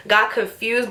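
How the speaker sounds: normal talking voice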